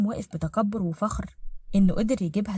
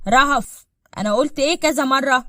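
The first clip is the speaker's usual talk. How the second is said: raised, louder parental rebuke; stern scolding; 4 semitones higher, 6 dB louder than usual. Acted. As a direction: pull the child up sharply. Vater weist Tochter zurecht.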